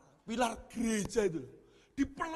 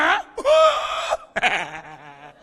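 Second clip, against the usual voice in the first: loud, wild crazed laughter; manic laugh; 5 semitones lower, 10 dB louder than usual. Acted. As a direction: cackle like someone gone mad.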